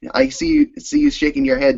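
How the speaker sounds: usual speaking voice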